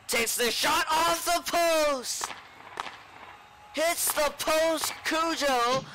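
high, drawn-out excited call; excited play-by-play. Acted. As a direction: call the post hit with rising excitement. Goal-post call.

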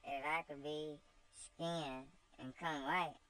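put-on cheeky girl shrugging off blame; voiced slave girl Topsy. Topsy answers her master with cheeky girlish voice.

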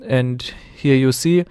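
ordinary delivery

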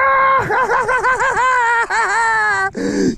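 long, very high cackle; shrieking, giddy laughter; real triumphant laughing fit; hysterical laugh. Real, not acted. Laughing over the catch.

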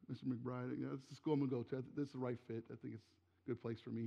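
the nervous student being on his best behaviour; soft, careful words to a feared teacher; timid hush. Retold or imitated.

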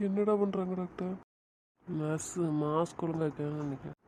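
usual speaking voice

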